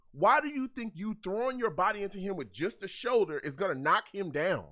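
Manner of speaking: ordinary delivery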